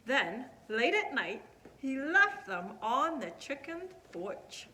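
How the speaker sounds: usual speaking voice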